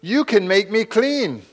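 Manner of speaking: a leper crying out to Jesus to be made clean, loud and pleading. Acted. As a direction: call out to Jesus for mercy. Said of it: "And he cried out".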